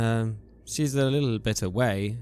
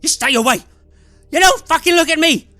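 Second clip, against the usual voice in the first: screamed much higher and much louder, hoarse; hoarse screaming; 14 dB louder, faster than usual. Acted. A haggard man screaming at an intruder, hoarse shriek. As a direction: scream them away in terror and rage. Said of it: "a hoarse voice screaming".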